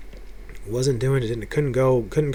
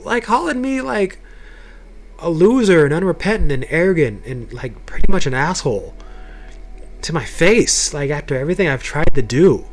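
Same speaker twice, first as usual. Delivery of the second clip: raised, high-pitched retold confrontation; indignant reproach, pushed higher as it's retold; letting him have it; 6 semitones higher, 3 dB louder than usual. Confronting the elder who treated him badly, letting him have it. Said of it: "I let this dude have it".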